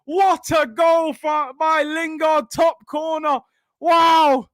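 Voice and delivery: big excited shout, voice up high; real elated yell at a late winner; goal celebration shout. Real, not acted. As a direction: roar out the goal like a fan. Goal celebration on a watchalong stream.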